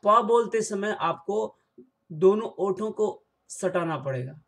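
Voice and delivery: ordinary talk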